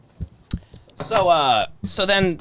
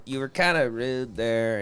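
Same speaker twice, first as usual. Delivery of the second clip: softer, lower and slow aside; quiet aside; 7 semitones lower, 7 dB quieter than usual. Retold or imitated. Telling Stan off at the table, softer and slow. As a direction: murmur it to him so no one hears. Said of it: "I go".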